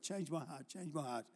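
soft muttered prayer under the breath. Retold or imitated. Praying under his breath in the office. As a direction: quietly pray it to yourself so nobody hears. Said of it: "I was saying under my breath".

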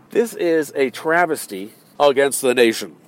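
grave orator's take on the line; mock solemn speech. Shown as a demonstration. Speaker tries out a grand proclamation, mock solemn. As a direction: deliver it like a statesman. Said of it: "How'd you like that?".

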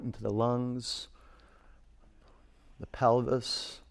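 ordinary talk

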